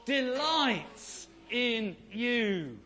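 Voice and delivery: slow, loud declaration said together; a slow, loud call for everyone to repeat; congregational response. Real, not acted. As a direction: lead the room in saying it, slowly and loudly. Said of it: "can you say it with me?".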